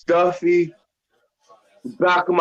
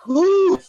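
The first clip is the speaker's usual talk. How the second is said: high pained whew; 13 semitones higher than usual. Real, not acted. Blowing out after the hot chip.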